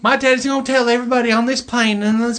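put-on high, loud girlish squeal; mock little girl voice. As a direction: imitate a bratty little girl. Mocking a girl's movie quote.

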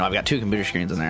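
ordinary delivery

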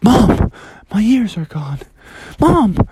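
high, alarmed frog calling out. Acted. Froggy calls to his mom about missing ears, alarmed. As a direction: cry out for mom in mock panic.

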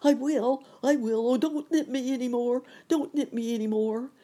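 high, loud pleading howl. A narrator's reading. Pessimist surrendering to the pinching bug, high loud howl. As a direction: howl and beg for mercy. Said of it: "howled the pessimist".

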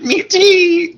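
very high, drawn-out mock squeal. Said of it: "What you're not about to do is be like".

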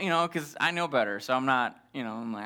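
ordinary talk

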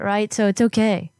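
usual speaking voice

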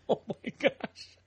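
laughing. Real, not acted. Laughs at thumb joke.

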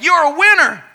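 high, loud echo; imitated echo holler; echoed call. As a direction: let it ring back from the mountains. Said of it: "the voice came back".

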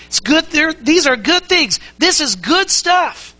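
relishing good stuff, stretched slowly; savoring emphasis. Acted. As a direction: lift it up and linger on good. Preacher savoring good news.

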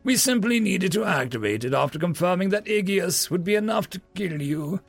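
high, gloating villain's explanation; haughty old magistrate crowing; boastful. A narrator's reading. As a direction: boast over a trapped foe. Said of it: "the old magistrate boasted".

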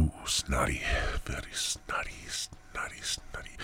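fading into a near-silent whisper; whispered muttering. Real, not acted. Host whispers to himself about snot. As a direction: mutter to yourself, trailing off.

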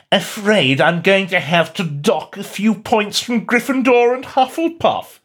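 high, slow drawling sneer; drawling Malfoy. Acted. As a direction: draw it out like a smug schoolboy. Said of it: "he drawled".

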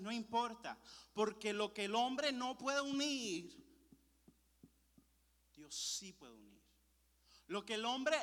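slow, emphatic preaching building to a shout; forceful preaching. Real, not acted. Preacher assuring the congregation God has control, forceful preaching.

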